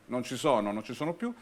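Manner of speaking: usual speaking voice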